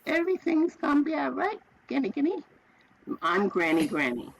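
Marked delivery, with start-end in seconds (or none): 0.1-2.4 put-on high, childlike voice of a two-year-old; little granddaughter. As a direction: sweetly comfort her grandma like a toddler. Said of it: "looked at me and said".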